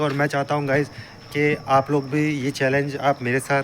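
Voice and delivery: usual speaking voice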